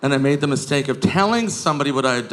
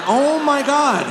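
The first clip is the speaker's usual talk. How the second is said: higher mock bystander, exasperated; annoyed bystander; 6 semitones higher than usual. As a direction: roll your eyes at the screaming girls. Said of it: "Everyone's like".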